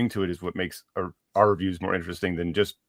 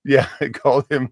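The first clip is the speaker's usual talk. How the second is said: ordinary delivery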